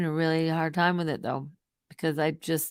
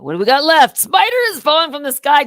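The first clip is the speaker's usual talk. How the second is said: loud, high eureka; mock-excited plotter; triumphant idea; 9 semitones higher, 10 dB louder than usual. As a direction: blurt it out like a brilliant plan. Planners' big new idea.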